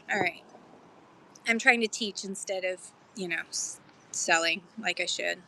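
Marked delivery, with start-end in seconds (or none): 0.1-0.4 whisper. Real, not acted. Whispered aside.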